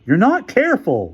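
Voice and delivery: high, loud scolding; raging. Acted. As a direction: rage at someone's carelessness. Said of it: "Larry raged".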